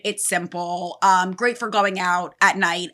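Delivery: ordinary talk, nothing marked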